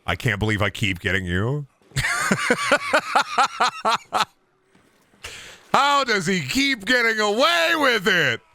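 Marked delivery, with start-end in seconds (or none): 1.9-4.2 long, high-pitched staccato cackle; cackling. Real, not acted. Streamer cackles at his trick. 5.7-8.4 high, drawn-out mock wail; mock outrage. Mock outrage after his prank.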